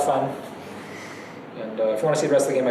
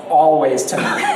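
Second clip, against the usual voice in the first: put-on Chinese accent. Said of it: "try to say it like he does with his thick Chinese accent".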